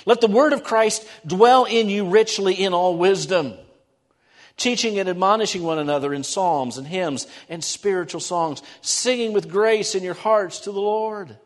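scripture reading. Acted. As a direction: proclaim the verse to the congregation. Reads the verse aloud.

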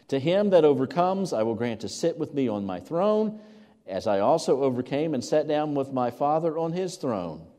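opens high and loud, then steady and lifted; proclaiming scripture. Acted. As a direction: announce the promise with weight.

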